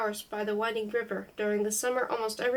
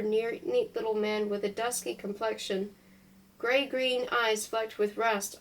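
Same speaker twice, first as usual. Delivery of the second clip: ordinary delivery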